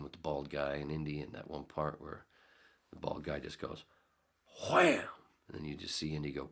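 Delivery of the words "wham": loud high "wham" for a movie punch; punch sound effect. Retold or imitated. Retelling the Raiders fight, a loud punch sound effect. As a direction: bark the hit like a cartoon fist landing.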